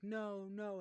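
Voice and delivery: high, frantic no no; panicked no. Retold or imitated. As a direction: squeal it out in a panic. Boy panics as cities fail.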